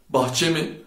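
ordinary delivery

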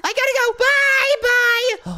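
high, loud squealed farewell; panicked goodbye. Acted. Caught spy escapes from Zoe, panicked high yell. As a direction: blurt goodbye and flee. Flees after being caught.

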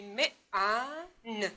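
من sounded out slowly as م, long ا, ن; sounding out. Shown as a demonstration. Teacher showing pupils three sounds in من, sounded out one by one. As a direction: draw out each sound of من separately. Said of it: "صدا کشی باید تکرار شه".

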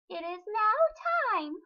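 high, slow motherly fish; mama fish voice. A narrator's reading. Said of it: "said the mama".

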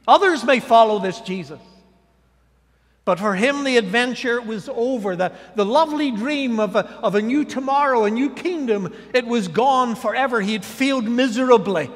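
loud, rising pulpit delivery, each line hammered; full-force preaching. Acted. Pastor preaching Peter's failure to the congregation, loud and rising. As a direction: preach it out hard over the congregation.

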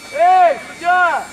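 slow, drawn-out holler; shout at referee. Real, not acted. Disputing whose ball it is with the referee, drawn-out shout. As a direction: call out long to get the referee's attention.

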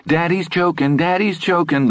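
put-on high little girl's voice, nervously cheerful; a child chirping half-scared, half-amused; nervous good humor. A narrator's reading. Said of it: "with nervous good humor".

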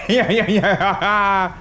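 slow sing-song yeah yeah; taunting. Real, not acted. Gamer taunting an enemy who can't touch him, slow sing-song. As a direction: tease the enemy with each yeah.

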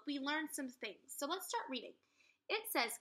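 ordinary delivery

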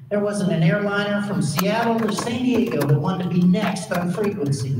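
ordinary talk, nothing marked

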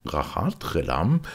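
ordinary delivery, nothing marked

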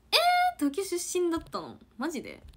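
loud, high-pitched burst of astonishment; real surprise. Real, not acted. Streamer learns someone is from Tokyo, surprised. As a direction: react in real disbelief, voice leaping up.

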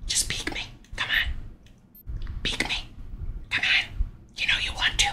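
hushed taunting whisper; whispering while hiding. Real, not acted. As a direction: whisper so the enemy can't hear. Hiding, taunting enemy to peek.

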